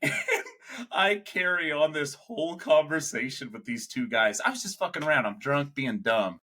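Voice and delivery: ordinary talk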